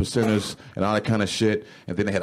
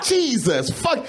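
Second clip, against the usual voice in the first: exasperated groan; 13 semitones higher, much slower than usual. Retold or imitated.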